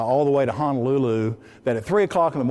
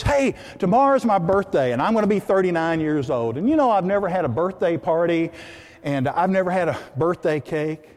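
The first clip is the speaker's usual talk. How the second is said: higher-pitched, boisterous announcement; loud and boisterous; 5 semitones higher, 3 dB louder than usual. Acted. Woman telling her friends about her birthday, boisterous. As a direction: call it out to the whole group. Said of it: "They're kind of loud and boisterous".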